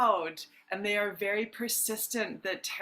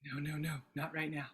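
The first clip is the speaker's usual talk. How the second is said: soft, low muttered aside; quiet aside; 3 semitones lower, 6 dB quieter, faster than usual. Real, not acted. Coach to people messaging her mid-session, quiet aside. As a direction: brush it off under your breath.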